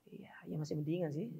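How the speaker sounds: a quiet breathy aside under the breath; whispered aside. Real, not acted. Teacher whispering a wry aside to the class. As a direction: drop it to a hush as a private comment.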